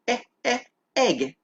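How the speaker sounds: eh, eh, egg broken into sounds; sounding out egg. Shown as a demonstration. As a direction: split egg into its first sound. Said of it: "Can you say".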